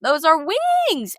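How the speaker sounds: high, drawn-out astonishment; amazed discovery. Retold or imitated. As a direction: stretch it out high in mock wonder. Discovers wings on his back.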